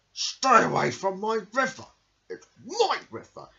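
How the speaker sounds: loud, high crocodile yell; crocodile shouting. Acted. Crocodile shouting at the forest creatures, furious and loud. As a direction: bellow at the forest creatures to keep off. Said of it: "he shouted to the creatures".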